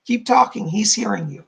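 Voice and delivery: ordinary talk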